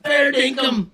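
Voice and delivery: high, loud, excited calling out; excited yelling. Real, not acted. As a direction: point and shout at him, delighted. Yelling, pointing out friend on camera.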